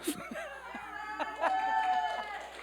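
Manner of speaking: a burst of high giggling laughter. Real, not acted. Calling Jared to rise, a high delighted laugh.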